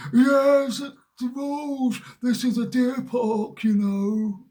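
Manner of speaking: high, loud mock yell of a rule-obsessed old man; high hammed-up telling-off; scolding old man. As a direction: shout it as the fussy old geezer. Old man insisting on the rules.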